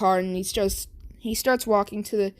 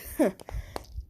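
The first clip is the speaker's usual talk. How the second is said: mocking laugh; 5 dB quieter than usual. Bakugo finds Deku in forest.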